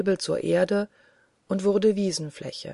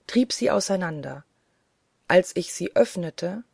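ordinary delivery, nothing marked

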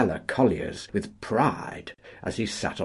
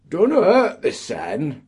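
much higher, louder dialect jibe; mocking retort; 11 semitones higher, 9 dB louder than usual. A miner jeers at the lazy union agent, high and loud. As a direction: toss off a cheeky miner's jeer. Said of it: "was the retort".

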